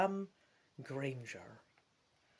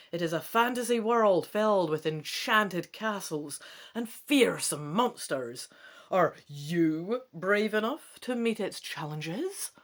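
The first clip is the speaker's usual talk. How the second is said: louder, punchy trailer read; dramatic blurb reading; 6 dB louder than usual. Acted. Gamer reading the blurb to viewers, dramatic trailer read. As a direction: sell the adventure like an ad. Reads back-cover blurb aloud.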